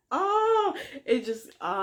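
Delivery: a sudden high catch of emotion, fighting back tears; choked up. Real, not acted. Vlogger moved by the book's line, almost crying. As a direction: let the voice crack on a rising oh as tears come. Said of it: "I'm trying not to cry right now".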